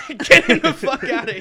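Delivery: high, loud laughing mock yell. Friend voices the show producers kicking him out, laughing mock yell. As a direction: bark it out through laughter like a fed-up producer.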